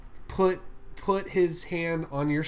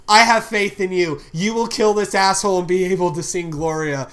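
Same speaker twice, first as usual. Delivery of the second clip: loud, raised-pitch rallying cry; rally your comrade before the kill; loud, emphatic vote of confidence; rousing pep talk; 4 semitones higher, 9 dB louder than usual. Acted. Character rallying an ally to fight.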